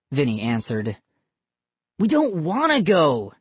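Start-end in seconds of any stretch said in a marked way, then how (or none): 2.0-3.3 put-on high, whiny child; whining. A narrator's reading. Boy protests having to leave, whining. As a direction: whine it like a kid being dragged home. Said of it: "Johnny whined".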